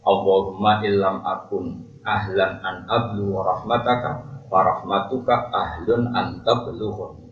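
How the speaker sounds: slow, measured Arabic prayer chant; prayer recitation. Acted. Preacher recites the Prophet's prayer to the congregation, slow and devout.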